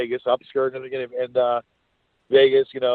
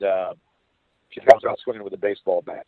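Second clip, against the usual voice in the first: ordinary talk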